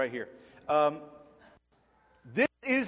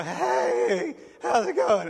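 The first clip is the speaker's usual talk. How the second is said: put-on higher, cracking teen voice; a nervous thirteen-year-old whose voice breaks; squeaky teen greeting, louder and higher; 8 semitones higher, 5 dB louder, much slower than usual. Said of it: "And my voice is cracking".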